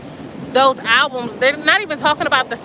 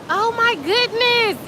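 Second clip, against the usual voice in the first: awed; 9 semitones higher, slower than usual. Real, not acted. Marvelling at the glowing water.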